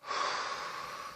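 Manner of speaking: a long, soft, breathy sigh letting go; quiet unvoiced sigh of relief; big release sigh. Real, not acted. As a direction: exhale it all out in one big sigh. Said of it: "I can feel a nice big sigh".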